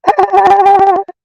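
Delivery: high rapid-fire cackle; high staccato laugh picked up from a friend; laugh like Joe Cool. Real, not acted. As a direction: let out a long, high chattering laugh. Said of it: "Don't I sound just like you".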